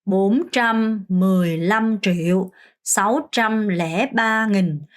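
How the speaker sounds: very slow, syllable by syllable; careful teacher's slow count; slow demo reading. Shown as a demonstration. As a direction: spell each number out slowly for learners. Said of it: "숫자를 보고 그냥 바로 읽는 연습".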